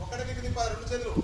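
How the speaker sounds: ordinary talk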